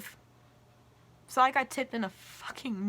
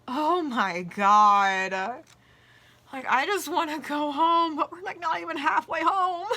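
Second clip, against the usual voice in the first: exasperated outburst; 5 semitones higher, 8 dB louder than usual. Retold or imitated. Inner panic in the car.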